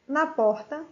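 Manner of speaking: ordinary talk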